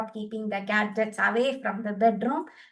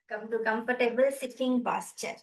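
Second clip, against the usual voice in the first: ordinary talk, nothing marked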